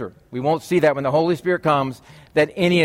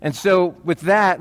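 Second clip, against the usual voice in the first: ordinary delivery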